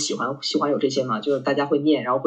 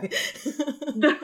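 laughing; 10 semitones higher, 6 dB quieter than usual. Real, not acted. Laughs at friend's tease.

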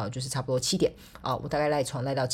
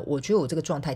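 ordinary talk

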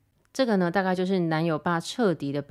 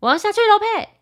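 high, loud call across the flat; impatient shout; 9 dB louder than usual. Retold or imitated. Boyfriend's dad calling her from afar, loud and impatient. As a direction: yell it impatiently toward the bedroom. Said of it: "往我的房间那边喊说".

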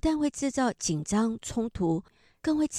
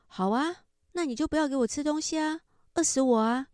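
higher, mock-defiant comeback from the son; brusque retort; 7 semitones higher than usual. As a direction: toss it back sharply, half-acted. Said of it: "语气也很冲的反驳".

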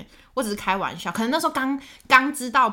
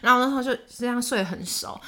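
usual speaking voice

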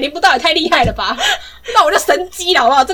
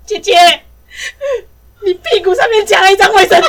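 giggling through the words, higher and louder; a little sister who can't stop laughing; little sister pointing out the toilet paper, laughing; 7 semitones higher, 8 dB louder than usual. Retold or imitated.

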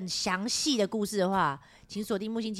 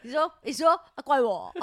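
mock whiny blame; 4 semitones higher, 5 dB louder than usual. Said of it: "人家说怪我".

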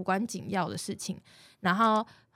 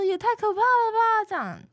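shocked; 13 semitones higher, 4 dB louder than usual. Retold or imitated. Recalls being scared by the prediction.

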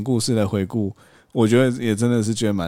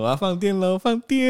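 cutesy flirt; 10 semitones higher than usual. Retold or imitated. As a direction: mimic a lovesick cutesy flirt. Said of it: "你说".

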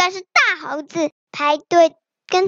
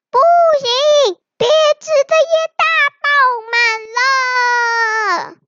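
high, stretched-out refusal; protesting crowd shout; 8 semitones higher, faster than usual. A narrator's reading. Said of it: "一大群动物在下面大喊大叫".

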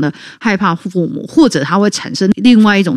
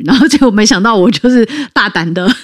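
ordinary delivery

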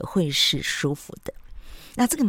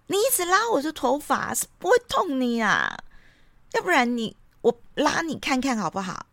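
much higher, shrill-edged retort in a mock child's voice; loud complaint; 10 semitones higher than usual. Daughter yells at mom over hair brushing, loud and high. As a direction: play the angry daughter snapping while her hair is pulled. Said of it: "就大声地说".

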